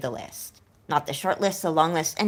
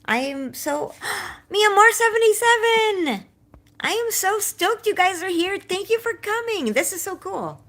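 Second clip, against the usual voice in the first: gasp, then a high, loud squeal of welcome; giddy host greeting a familiar face; excited greeting; 13 semitones higher, 3 dB louder than usual. Real, not acted. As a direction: shriek with joy at a friend walking in. Spots a friend arriving.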